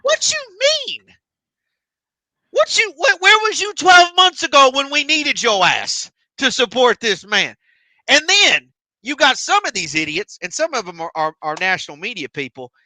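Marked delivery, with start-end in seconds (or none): 0.0-7.5 much higher, indignant disbelief; mock incredulous. Fan talks back to media critics, incredulous and indignant. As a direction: squeak out the disbelief at the fickle media. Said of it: "I'm sitting thinking, like".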